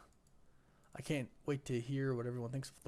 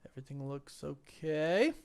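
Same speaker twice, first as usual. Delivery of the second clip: usual speaking voice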